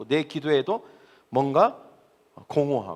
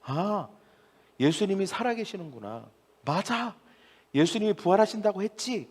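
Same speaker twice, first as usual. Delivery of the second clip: soft, higher voice of sudden recognition; dawning realization; 5 semitones higher, 5 dB quieter than usual. Acted. Preacher acts Mary realizing Jesus is alive, hushed amazement. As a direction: let it dawn on you, hushed and amazed. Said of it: "알아챘어요".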